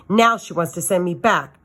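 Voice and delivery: usual speaking voice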